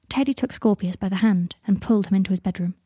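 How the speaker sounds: usual speaking voice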